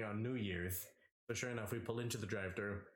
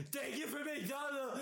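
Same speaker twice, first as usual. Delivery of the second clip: high, laughing mock thanks; laughing while quoting; 12 semitones higher than usual. Quoting a drunk friend's thanks, high and laughing. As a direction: squeal out the thanks mid-laugh.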